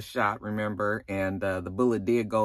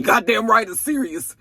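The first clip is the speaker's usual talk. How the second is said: gruff chief snapping back; a hint of an angry chief; mock angry chief; 8 semitones higher, 5 dB louder than usual. Imitating the chief snapping at the investigator. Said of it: "the chief was like".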